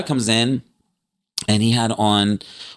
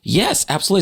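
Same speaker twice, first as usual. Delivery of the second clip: high-pitched eager agreement; fired up; 10 semitones higher, much faster than usual. Retold or imitated. Agreeing with a fellow superhero fan, fired up. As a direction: jump in excitedly, pitched well up. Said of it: "I'm getting all fired up".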